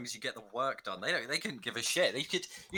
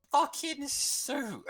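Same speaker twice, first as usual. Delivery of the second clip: very high, slow fucking suit; high outrage; 13 semitones higher, much slower than usual. Real, not acted. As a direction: squeal it in disbelief. Complaining about having to wear suits.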